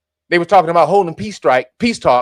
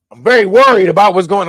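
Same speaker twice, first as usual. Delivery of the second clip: lightly hammed-up displeasure, louder; mock pissed; 6 dB louder than usual. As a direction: pretend to be very cross. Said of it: "like he was pissed at Israel".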